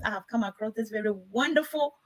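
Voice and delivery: usual speaking voice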